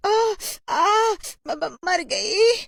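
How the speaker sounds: very high stammering scream; panicked shriek. Acted. A girl screams as lice land on her, terrified. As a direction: shriek and stretch the last word.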